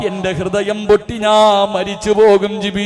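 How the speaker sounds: loud chanted lament with long wailing endings; imitated tearful plea. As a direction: plead in a preacher's stylised cry. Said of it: "കരഞ്ഞുകൊണ്ട് പറയുന്നു". (കരച്ചിൽ അനുകരിച്ച് ഈണത്തിലുള്ള അപേക്ഷ)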